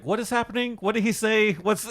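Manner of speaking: high-pitched puzzled questions; baffled. Retold or imitated. Podcaster retelling being lost during the movie, baffled. Acting out confusion during the film.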